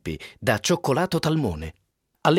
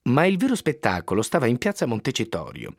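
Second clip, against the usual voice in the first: plain reading voice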